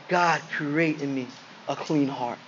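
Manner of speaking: low, soft plea; hushed prayer. Acted. Preacher repeating David's plea to God, hushed and prayerful. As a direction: drop down low and quiet, praying it.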